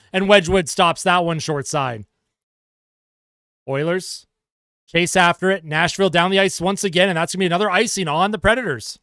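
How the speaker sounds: brisk hockey play-by-play call; sportscaster calling the action. Acted. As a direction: call the game live as it happens. Live play-by-play of hockey game.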